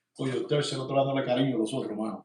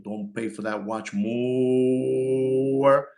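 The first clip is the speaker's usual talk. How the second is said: slow drawn warning; much slower than usual. Real, not acted. Dragged-out warning to buyers.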